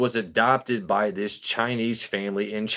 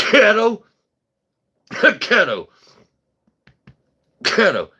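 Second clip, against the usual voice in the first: laughing; 11 semitones higher, 8 dB louder than usual. Real, not acted. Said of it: "excuse me".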